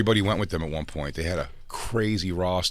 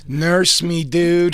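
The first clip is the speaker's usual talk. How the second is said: high, loud whine; hammed-up sick baby; mock whiny plea; 10 semitones higher, 6 dB louder, much slower than usual. Begging the girlfriend for care, high whine.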